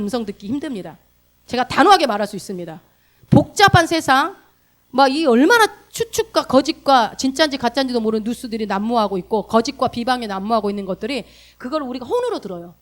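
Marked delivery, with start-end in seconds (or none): none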